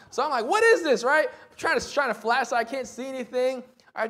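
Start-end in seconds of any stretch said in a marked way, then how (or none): 0.5-1.0 high, loud incredulous question; retold baffled outburst; loud bafflement. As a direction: exclaim in exasperated confusion. Baffled by mystery noise.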